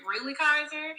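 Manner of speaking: amused disbelief. Retold or imitated. Stepmother retelling her reaction to Kaiser's dramatics, amused disbelief. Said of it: "And I was laughing".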